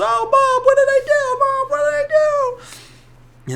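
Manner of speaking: very high, loud squealed plea; wailing kid. Retold or imitated. Schwartz pleads as his mom hits him, wailing. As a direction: imitate a boy howling in protest. Boy wails while being hit.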